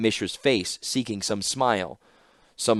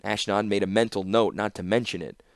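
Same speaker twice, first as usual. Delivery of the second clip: plain reading voice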